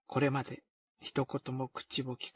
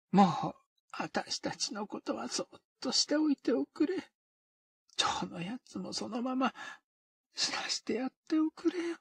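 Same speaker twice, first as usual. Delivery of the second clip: higher, pleading, partly whispered; imploring; 7 semitones higher than usual. Acted. O-fumi begs O-kei to let them be, imploring. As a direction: beg her to leave it be. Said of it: "哀願するように言った".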